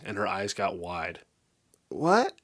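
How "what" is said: higher, startled rising what; stunned question; shocked. A narrator's reading. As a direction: caught off guard. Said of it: "she asked in a shocked voice".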